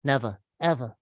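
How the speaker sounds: Al Pacino impression. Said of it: "given a big speech by Al Pacino".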